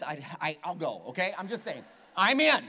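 ordinary talk, nothing marked

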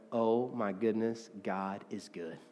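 soft, low and slow mock awe; imagined hushed amazement; overwhelmed, mildly acted. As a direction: act out being hushed, near tears. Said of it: "start crying or freaking out and going".

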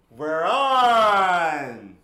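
big call that they're on air; loud announcement. Real, not acted. Podcast start announcement.

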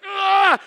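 growing-fed-up outburst, acted out; loud frustrated yell. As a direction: let out a loud cry of frustration. Retold boiling frustration.

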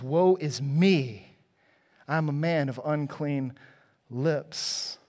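drawn out slowly, each word of Woe is me weighed like a confession; slow it right down, letting the words sink; slow lament. Acted. Preacher slowly voicing Isaiah's lament.

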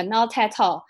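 "not at all" said as "nao-tai-tao"; imitated mispronunciation. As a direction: stress the T and drop the L in "not at all". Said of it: "重音放在了T上面".

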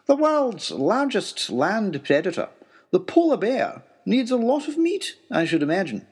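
the professor lecturing. Acted. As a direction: explain it like a pedantic professor. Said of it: "interjected the professor".

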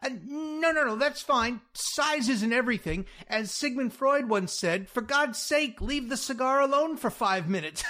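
high, loud, nervous babble; flustered bluffing. Acted. Jack covering up to Louise with a Freud quote, flustered. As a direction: cover up with over-eager, high-pitched patter. Fake therapist babbling reassurance.

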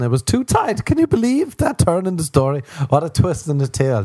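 high-pitched fake shock at a plot twist; mock amazement. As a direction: sell a tiny twist as astonishing. Mock amazement at own dull story.

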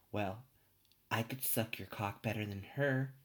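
put-on sleazy celebrity voice; hammed-up flirting in an actor impression; Kevin Spacey. Actor propositioning a barman, hammed-up impression.

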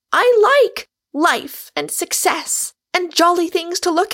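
high, loud cyclone of scorn; wounding tirade. A narrator's reading. Rosamond attacks Michael's ways and failures, furious wounding tirade. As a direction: let loose, speaking every word to wound. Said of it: "speaking every word to wound".

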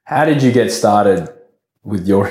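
ordinary delivery

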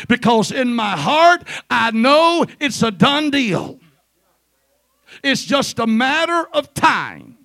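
loud, high-pitched preacher's declaration; full-force preaching. Real, not acted. Preacher rallying the church to praise in advance, full-force preaching. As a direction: proclaim it to the congregation with rising force.

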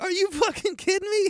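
high, incredulous exasperated yell; mock angry outburst. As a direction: imitate someone losing it in disbelief. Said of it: "going like".

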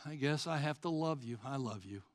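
low, soft, reluctant declaration of love; half-hearted, sinking monotone; grudging, passionless. Acted. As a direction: say it as a dreary obligation. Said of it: "No, he loves us passionately".

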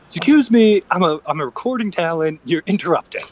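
mock haughty complaint, starting loud; huffy mock protest; indignant. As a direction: play the offended recording talent. Mock indignant to train announcer.

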